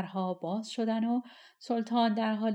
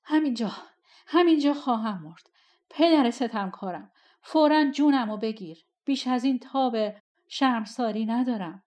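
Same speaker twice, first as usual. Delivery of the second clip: loud, high, desperate plea; breathless cry to be killed; gasping despair; 3 semitones higher, 7 dB louder than usual. A narrator's reading. Amir throws himself before the throne: loud, breathless plea.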